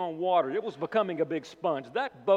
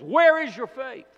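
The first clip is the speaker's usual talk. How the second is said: rebuking question; 9 semitones higher, 8 dB louder than usual. Pretend. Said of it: "as Mark says".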